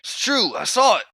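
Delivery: very high, loud squawk; eager tattling bully. Acted. One of the bullies backs up Toad, high loud tattling. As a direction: pipe up at the top of your voice.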